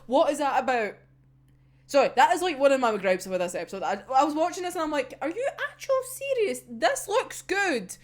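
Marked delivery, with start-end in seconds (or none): 5.2-8.0 retold incredulous outburst. Podcaster replaying their disbelief at an episode, incredulous. Acting out an exasperated reaction.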